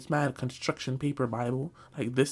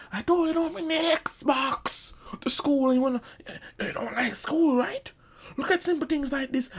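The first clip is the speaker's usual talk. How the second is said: mock the stepdad lecturing, pitched way up; stepdad's voice; 12 semitones higher, 4 dB louder than usual. Stepdad voiced in retold beating.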